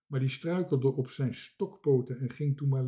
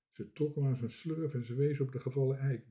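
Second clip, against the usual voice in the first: usual speaking voice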